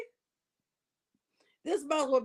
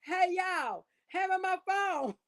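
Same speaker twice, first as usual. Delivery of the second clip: higher, pleading voice asking for her phone; mock patient begging; 6 semitones higher, slower than usual. Mocking the woman locked in the ward, a higher pleading voice. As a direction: whine it out like a hospitalized diva. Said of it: "in that mental institution saying".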